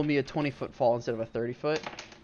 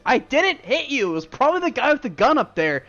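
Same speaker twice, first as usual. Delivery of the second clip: lightly voiced high protest; indignant denial; 10 semitones higher, 6 dB louder than usual. Lightly voiced ally protesting to the giant. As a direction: insist you're innocent, pitched up. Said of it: "And he goes".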